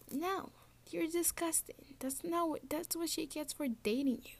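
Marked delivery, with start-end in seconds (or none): none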